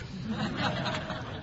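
soft breathy laugh; a quick amused laugh; light laugh. Real, not acted. Storyteller laughs at the devotee's shock, softly.

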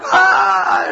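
a frightened shriek from sleep; screaming in sleep. Retold or imitated. Dreaming devotee still screaming, shriek.

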